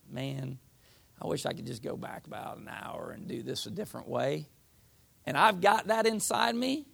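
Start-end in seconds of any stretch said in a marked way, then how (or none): 0.1-4.5 sigh, then very soft and low; quiet, heavy-hearted musing to yourself; acted regret. Believer wishes to undo his stumble, acted regret.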